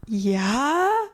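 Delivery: high, breaking into laughter; a dazed yes that cracks up; stunned yes into a laugh. Retold or imitated. A teen answering a creepy customer, laughing in shock.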